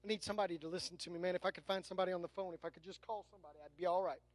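low, soft, hurried grumbling; mock pleading. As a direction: mimic a needy complainer, quick and under the breath. Voices someone wanting a counselor instead.

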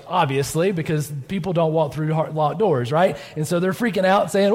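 normal talking voice

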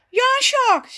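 high, loud, eager figure voice; eager youngster. A narrator's reading. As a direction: pipe up keenly in agreement. Raf eagerly agrees, wanting cake. (gretig, hoog en luid stemmetje)

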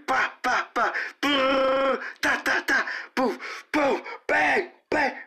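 high-pitched vocal gunfire; rapid mouth-made bursts and bangs, pitched very high; imitated gun sounds. Recalling the rapper gun sounds sketch, imitated gunfire.

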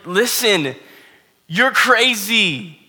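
pushing Ruth away, loud and high; insistent Naomi. Acted. Preacher voicing Naomi insisting.

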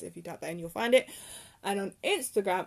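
ordinary delivery

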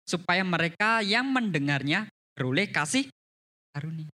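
loud, emphatic Bible reading; scripture reading. A narrator's reading. Preacher reads a verse to the congregation, loud and emphatic. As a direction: proclaim the verse with measured force.

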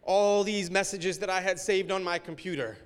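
normal talking voice

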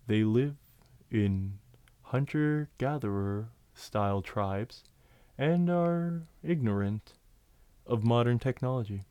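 slow, drawn-out turtle voice with long pauses; turtle impression. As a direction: speak like an ancient, unhurried tortoise.

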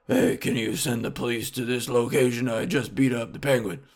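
put-on superhero voice; mock vigilante calling it in; Batman. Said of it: "Batman will put his finger in his ear and be like".

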